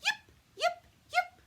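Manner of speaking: very high, loud yips; fox yipping. Acted. Little fox on the hill calling to the party, very high loud yips. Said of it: "She called".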